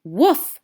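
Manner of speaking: a short loud woof, like a happy dog; dog bark. Acted. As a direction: bark it out like an eager puppy. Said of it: "barked Einstein".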